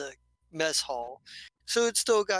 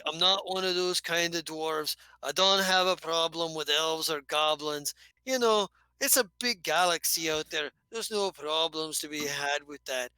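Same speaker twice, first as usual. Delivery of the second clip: eager dwarf. Acted. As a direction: reassure her, then get to the real question. Dwarf reassures elf player.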